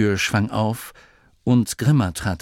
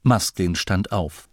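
ordinary delivery

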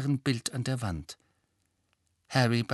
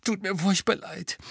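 high, trembling apology; shaky voice; 10 semitones higher than usual. A narrator's reading. Molly apologizing shakily to Harry after crying. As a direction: let the voice tremble as you apologize. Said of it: "sagte sie zittrig".

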